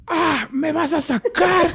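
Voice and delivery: high, stretched-out taunting challenge; mock defiance. Acting out drunk friend's defiance. (reto burlón, agudo y alargado)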